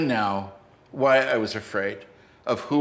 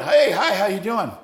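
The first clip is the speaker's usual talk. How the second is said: high, louder retold hello; cheery greeting; 8 semitones higher, 4 dB louder than usual. Friend greeting a visitor in the dressing room, cheery. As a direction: imitate an upbeat, friendly greeting.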